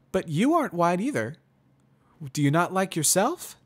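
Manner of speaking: high, loud, musing pony; mare voice, considering. Acted. Pony points at prisoner.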